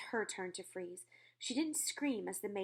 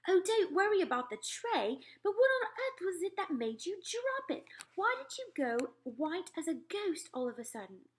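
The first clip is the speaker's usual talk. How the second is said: raised, shaken royal voice; a queen still upset by a nightmare; queen's voice; 10 semitones higher, 4 dB louder than usual. Acted. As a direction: tell the dream as a rattled queen. Queen presses the maid.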